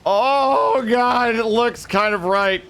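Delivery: high, giddy gasp into the words; excited realisation. Real, not acted. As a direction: jump up at the sudden good news. Realises he might be right.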